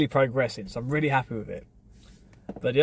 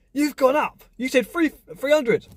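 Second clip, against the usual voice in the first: much higher, whiny neighbour; mock indignant neighbour; 10 semitones higher than usual. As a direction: squeak it out like an outraged haggler. Neighbour protesting the price rise.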